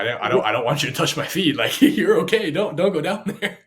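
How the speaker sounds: loud, laughing plea to stop; laughing protest. Retold or imitated. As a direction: beg her off through laughter. Pleading not to touch feet.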